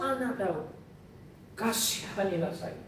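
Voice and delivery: kneeling woman quietly begs Jesus; humble plea. Acted. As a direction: plead softly on your knees. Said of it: "Dan dia mengatakan".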